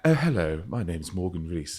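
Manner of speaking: husky, low RP accent; attempted RP. Shown as a demonstration. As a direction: try a proper RP introduction. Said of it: "I'm trying to do an R P accent".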